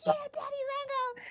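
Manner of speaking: very high, soft squeaky tease; friends teasing. Retold or imitated. Friends teasing her nickname, very high. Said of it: "They're like".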